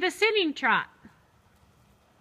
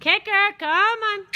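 excited urging; 6 semitones higher than usual. Real, not acted. Instructor urging horse and rider.

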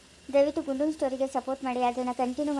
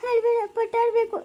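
crying voice, higher pitch; tearful girl; 8 semitones higher, 4 dB louder than usual. Acted. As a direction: speak through her sobs. (ಅಳುತ್ತಾ ಮಾತಾಡುವ ಹುಡುಗಿ)

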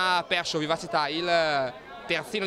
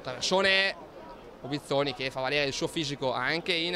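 ordinary talk, nothing marked

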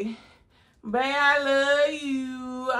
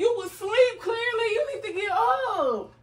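waking shout; 7 semitones higher than usual. Real, not acted. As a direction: rouse him out of bed with a high, half-laughing shout. Girlfriend waking him for prank.

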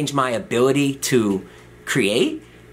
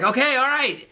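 very high, meek giving-in; mock placating; 14 semitones higher than usual. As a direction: back down in a high little voice. Patient placating yelling doctor.

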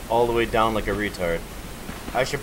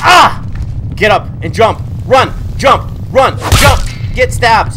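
loud frantic yells; frantic shouting; 6 semitones higher, 8 dB louder, slower than usual. Real, not acted. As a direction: shout orders in panic.

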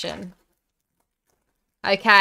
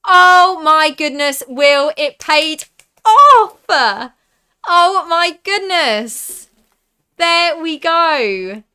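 high, loud, drawn-out celebration; thrilled shout; 7 semitones higher, 10 dB louder, slower than usual. Real, not acted. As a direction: stretch out the excited cheer for the big pull. Big pull from the pack.

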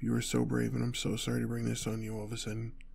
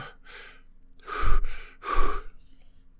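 heavy panicked blowing out of breath; loud forceful puffs of breath; loud shaken exhale; 12 dB louder than usual. Acted. Driver catching breath after escape, loud shaken exhales.

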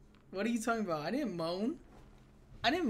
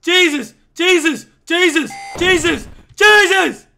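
very high, very loud repeated cries; panicked screaming; 8 semitones higher, 15 dB louder, slower than usual. Real, not acted. Gamer fleeing a monster, panicked repeated screams.

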